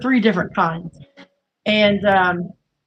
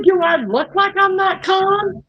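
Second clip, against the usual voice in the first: mock high protest; 9 semitones higher than usual. Retold protest to doctor.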